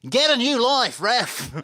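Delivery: high, loud mock heckle; shouting out like someone in the stands; retold heckler's shout. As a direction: imitate a football fan yelling at the referee. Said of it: "someone behind me shouted".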